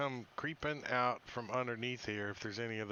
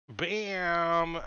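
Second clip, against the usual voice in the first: excited; 5 semitones higher, 9 dB louder than usual. Real, not acted. Landing the killing blow.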